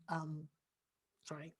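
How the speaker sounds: very soft, low tearful apology. Real, not acted. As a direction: apologise while holding back real tears. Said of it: "now that I'm crying".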